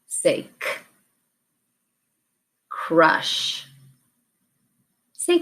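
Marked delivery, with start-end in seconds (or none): 0.6-0.7 k as a bare consonant sound, not "kay"; letter sound. Shown as a demonstration. Teacher gives the sound to replace, k as a sound. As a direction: say just the crisp sound of k. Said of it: "change to a new sound".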